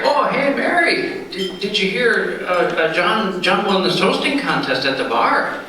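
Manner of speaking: teasing coworkers. Acted. Coworkers to the wife about the toast: high teasing sing-song. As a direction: bait her like giggling pub mates.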